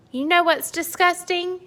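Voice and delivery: high, loud furious rant; ranting giant. Acted. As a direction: build up to the punch. Angry giant rants.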